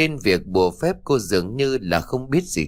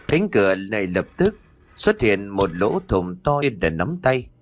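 ordinary delivery

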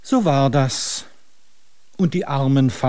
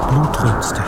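unvoiced whisper of bloodthirst; 3 dB louder than usual. Narrator whispering about the bloodthirsty god. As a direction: breathe the word like a threat.

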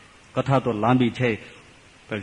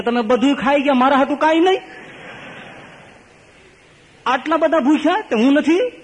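wailing complaint; 14 semitones higher than usual. Acted. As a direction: cry out that nothing is left for you. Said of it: "ધનો પોક મૂકીને રોવા માંડ્યો".

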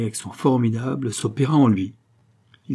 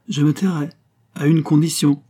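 higher, louder, deliberate answer; firm bargaining; 5 semitones higher, 4 dB louder than usual. Acted. Suitor offers silence to the lady, firm. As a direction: state the terms firmly, falling on the promise. Suitor sets his condition.

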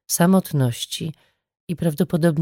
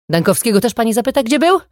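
sharp, higher, louder, fast and venomous; 7 semitones higher, 5 dB louder, faster than usual. A narrator's reading. Mother taunting the detective, venomous and sharp. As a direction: spit the question out with venom. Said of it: "zapytała jadowicie".

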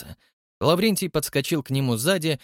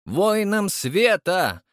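slow, high, loud proclamation; near shout; 7 semitones higher, 3 dB louder, much slower than usual. A narrator's reading. Old man announcing the title, near shout. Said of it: "почти закричал старичок".

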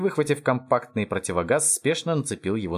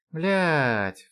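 drawn-out higher cry; screaming; 6 semitones higher than usual. A narrator's reading. Lound echoing his comrade's alarm, contained scream. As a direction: wail the curse, long and high. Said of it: "завопил и Лоунд".

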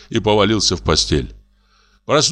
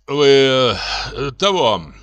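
halting, dumbfounded; 4 semitones higher, much slower than usual. A narrator's reading. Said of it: "Он долго шевелил губами и выговорил".

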